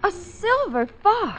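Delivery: gasped-out discovery, pitched high and drawn out; startled exclamation. Acted. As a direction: react in high, slow astonishment. Claire spots the fur scarf.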